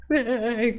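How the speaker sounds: short fond chuckle; a quick delighted laugh; little laugh. Real, not acted. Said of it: "que fofo".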